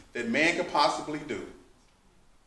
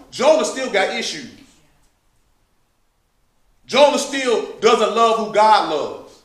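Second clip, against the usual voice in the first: loud, rising sermon voice, driving each point home; preaching at full force; 7 semitones higher, 9 dB louder than usual. Acted. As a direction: preach it to the saints with fire.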